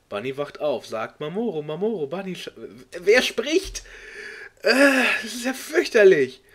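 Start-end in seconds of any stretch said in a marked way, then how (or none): 3.0-3.8 exasperated outcry. Real, not acted. Exasperated at unreadable fanfic. 4.6-6.4 louder and higher, aghast at the text; dismayed outburst. Real, not acted. Reader appalled by the fanfic, crying out in dismay.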